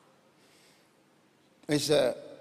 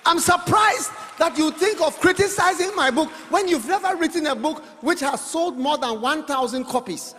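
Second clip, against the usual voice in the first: louder, higher shouted mock indignation; mock outrage; 7 semitones higher, 4 dB louder than usual. Real, not acted. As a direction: preach it hard, half joking. Preacher mock-scolds critics.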